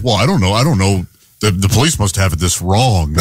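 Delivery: low, doubtful co-host impression; imitating Tom. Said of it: "when Tom looks at him, goes".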